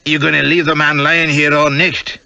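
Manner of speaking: high, indignant Scots voice; strong Scottish accent. A narrator's reading. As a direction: demand it in a broad Scottish accent. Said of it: "in a strong Scotch accent".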